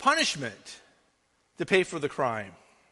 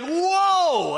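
mock shocked whoa; 9 semitones higher, 3 dB louder than usual. Retold shocked reaction.

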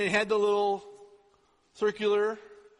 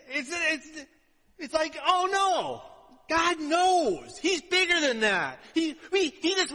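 fervent preaching; 7 semitones higher, slower than usual. Real, not acted. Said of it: "climax of the point".

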